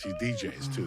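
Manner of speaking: ordinary delivery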